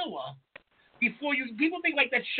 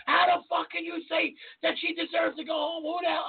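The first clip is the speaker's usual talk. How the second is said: imitated angry screaming; 8 semitones higher than usual. Angry viewer at the airport confronting contestant, imitated scream. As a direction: rant in mock outrage. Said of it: "scream at me".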